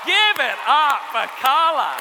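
loud, slow hype-man call; announcing his friend to the crowd, loud and slow; mock announcer. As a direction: introduce her like a stage announcer.

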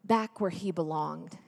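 normal talking voice

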